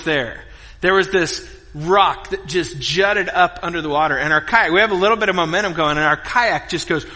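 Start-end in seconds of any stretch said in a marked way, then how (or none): none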